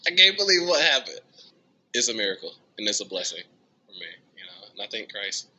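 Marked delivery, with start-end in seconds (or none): 0.1-1.2 still crying. Retold or imitated. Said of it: "And I was still crying".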